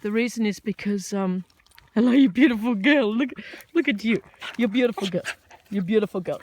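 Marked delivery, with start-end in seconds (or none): 1.9-6.4 loud cooing over the dog; pet talk. Real, not acted.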